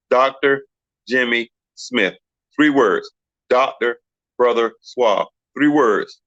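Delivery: spaced-out, deliberate counting demonstration; word by word. Retold or imitated. Retelling how he proved both names are three words, deliberately spaced. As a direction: set each word apart to prove a point.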